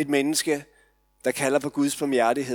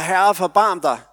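higher, louder desperate plea; louder cry for mercy; 6 semitones higher, 9 dB louder than usual. Retold or imitated. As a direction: cry out over the crowd, pleading. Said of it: "Der råbes højere".

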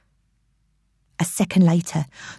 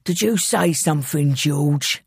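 lowered gruff villain voice; hoarse and gruff; 4 semitones lower than usual. Acted. Intruder questioning someone hidden, hoarse and gruff. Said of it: "said the hoarse, gruff voice".

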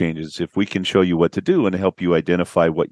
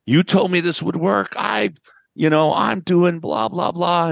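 high-pitched mock angry complaint; imitating a pissed client; 11 semitones higher than usual. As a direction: play the furious client, voice pushed way up and slowing on the blahs. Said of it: "he's pissed".

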